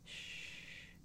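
ordinary talk

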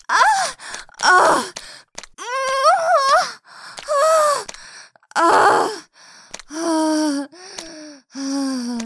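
high, loud moans, falling gradually in pitch. Acted. Climax with a partner, loud moans subsiding. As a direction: cry out, then let it sink.

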